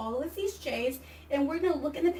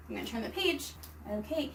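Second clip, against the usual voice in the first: ordinary talk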